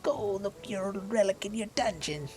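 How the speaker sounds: high, taunting little mite voice with a cackle; mocking mite. Mite seneschal taunting the kobolds, high and mocking. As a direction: jeer at the kobold like a smug pest.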